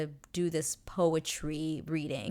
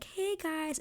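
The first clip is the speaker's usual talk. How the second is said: much higher, timid "hey guys"; mock pleading interruption; 12 semitones higher than usual. Outsiders trying to interrupt the bickering, much higher. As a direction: chirp it up high to break them up. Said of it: "trying to be like".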